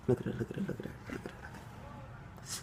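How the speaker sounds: fading into a whisper; cooing whisper. Real, not acted. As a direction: hush it down to a breathy coo. Cooing over the dog.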